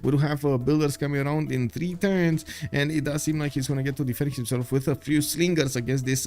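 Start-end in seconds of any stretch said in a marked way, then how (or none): none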